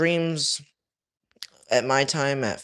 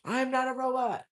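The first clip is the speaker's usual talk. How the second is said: much higher, squeaky-pitched protest; protesting viewer; 11 semitones higher, 6 dB quieter than usual. Acted. Streamer mimics a viewer denying being a bot, high-pitched protest.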